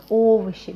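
"овощи" opening on a full, stressed O. Shown as a demonstration. Teacher showing the vowel rule, stressed O in "овощи". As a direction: hit the O of "овощи" loud and round as written. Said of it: "Because it is stressed, we pronounce it as O".

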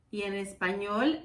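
ordinary talk

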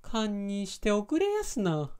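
high, drawn-out Kyoto dialect; Kyoto accent. Shown as a demonstration. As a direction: lilt it slowly like a Kyoto woman. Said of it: "京都なまりっていうの".

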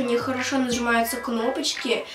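ordinary talk, nothing marked

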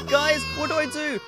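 ordinary talk

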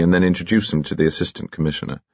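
usual speaking voice

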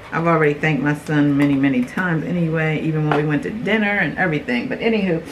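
usual speaking voice

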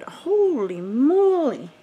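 awed gasp at the makeup; amazed exclamation. Real, not acted. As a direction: stretch it out in wonder. Unboxing, amazed.

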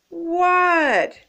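high, drawn-out startled call back; yelled reply. A narrator's reading. Froggy answers the coach, yelling back. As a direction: holler back in surprise.

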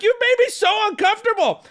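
high-pitched mock complaint; joking outrage. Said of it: "I'm smiling and joking through it".

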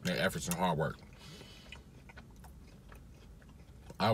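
chewing between words; talking while eating. Real, not acted. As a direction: hand over mouth. Seen 1: Holds food at lips, bites around 6.6s while talking.

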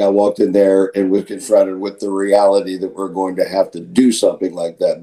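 usual speaking voice

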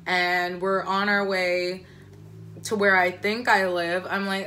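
usual speaking voice

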